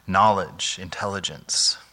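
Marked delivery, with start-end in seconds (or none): none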